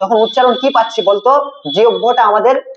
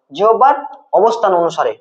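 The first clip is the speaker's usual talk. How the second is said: জিহ্বার held up, pause, অবস্থান অনুসারে falling; dictation pause; 2 semitones lower than usual. Shown as a demonstration. Teacher repeats it for dictation. (জিহ্বার, থেমে, অবস্থান অনুসারে)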